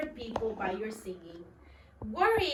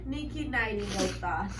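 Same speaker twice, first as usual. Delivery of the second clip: usual speaking voice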